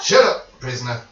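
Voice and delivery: loud barked order; guard yelling. Acted. Guard yells at the prisoner to shut up, loud. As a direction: snap it at the prisoner.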